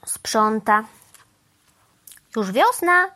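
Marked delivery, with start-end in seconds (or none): 2.4-3.1 higher, lightly called-out announcement; mild excited call. A narrator's reading. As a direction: call it out on a raised pitch. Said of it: "zawołała Mama Mu".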